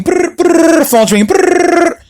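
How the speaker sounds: high buzzing brr of a ringing phone; lightly mimicked phone ring; phone ring imitation.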